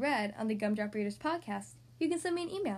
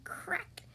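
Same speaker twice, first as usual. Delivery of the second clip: whispered crack effect; hushed, voiceless snap of breaking wood; cracking sound; 6 semitones higher, 6 dB quieter than usual. A narrator's reading.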